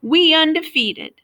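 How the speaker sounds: high, loud squawk. A narrator's reading. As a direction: squawk it out proudly. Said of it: "Lyle squawked".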